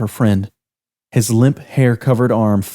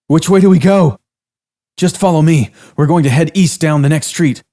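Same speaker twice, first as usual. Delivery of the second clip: higher, louder urgent exchange; running for their lives; frantic fleeing men; 6 semitones higher, 5 dB louder than usual. Acted.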